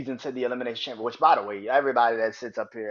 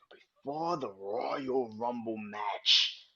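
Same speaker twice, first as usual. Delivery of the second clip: ordinary talk, nothing marked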